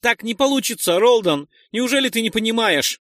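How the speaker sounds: outraged at a stubborn comrade; indignant. Acted. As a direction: plead with anger in your voice. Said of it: "возмутился Нойс".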